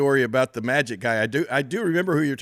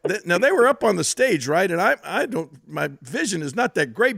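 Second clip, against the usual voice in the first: normal talking voice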